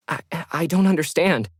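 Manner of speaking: Lauren stunned on the phone, stammering; stunned disbelief. Acted. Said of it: "Lauren was stunned".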